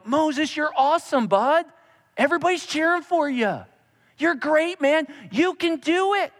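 high, peppy cheering; mock hype-man. Pastor mocking a God who just cheers Moses on, high and peppy. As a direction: pump him up like a cheesy coach.

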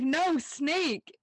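insistent mock alarm; mock urgent shout. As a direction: call the danger out urgently. Leo warning Piper again.

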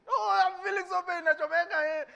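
high, hammed-up moaning in pain; mock pain whine. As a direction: whimper as if hurt, overplayed. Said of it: "we think gnashing of teeth is pain".

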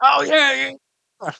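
high squeal of recognition; delighted high oh yeah; excited. Real, not acted. Excited about candy.

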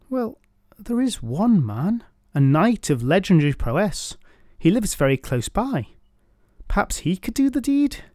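put-on young woman voice. Daughter speaks to father.

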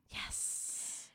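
soft hissed yes; whispered triumph. Real, not acted. Contestant pleased at a correct answer, whispered triumph. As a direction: celebrate under your breath.

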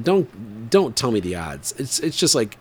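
ordinary delivery

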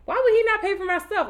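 high indignant question. Retold or imitated. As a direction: rise up in disbelief. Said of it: "I'm mad 'cause I'm like".

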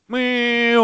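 imitated moped. Player mimicking a passing moped, drawn-out engine whine. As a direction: hold the moped noise out long. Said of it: "och moppen som åker förbi".

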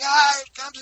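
high, loud imitation of kids screaming; screaming excited kids. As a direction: squeal it out like kids bouncing in the car. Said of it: "they're screaming".

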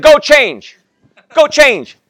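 a bossy wife ordering him back to change; wife's voice. Retold or imitated. Wife voiced in marriage story.

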